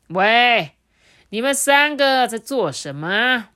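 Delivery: loud, slow tiger challenge; tiger roars. A narrator's reading. Tiger roaring at the three animals, loud and slow. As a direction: bellow it as the big tiger. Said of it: "老虎一吼啊".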